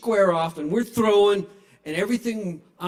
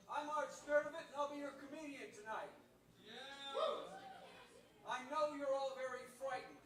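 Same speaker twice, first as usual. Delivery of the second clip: distant, thin voice as if from the back of the room; unmiked far-off voice; 10 semitones higher, 22 dB quieter than usual. Acted. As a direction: voice a comedian shouting from far away with no microphone. Shouting without a microphone.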